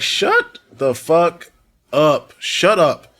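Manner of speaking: exasperated shout. Real, not acted. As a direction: snap at her to stop talking. Fed-up outburst at vlogger's claim.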